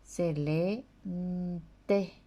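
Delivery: nte said slowly as a clear nasal nt plus e; slow spelling read. Shown as a demonstration. A teacher slowly modelling the Guarani syllable. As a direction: stretch se lee nte for pupils. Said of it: "N T I".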